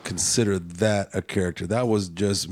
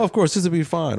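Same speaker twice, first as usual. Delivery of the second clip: higher-pitched, breezy false assurance; fooling pretender; 9 semitones higher, 3 dB louder, faster than usual. A man trying to get something from someone, mock reassurance. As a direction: sell it like you're fooling them. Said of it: "I'm trying to fool you in a way".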